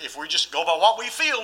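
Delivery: ordinary talk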